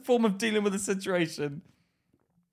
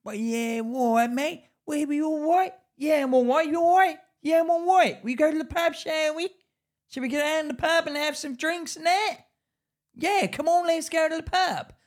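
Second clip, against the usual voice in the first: much higher, chirpy mock lad voice; British pub bloke; 9 semitones higher than usual. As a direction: lampoon the cheery pub regular. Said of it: "This is like a British culture".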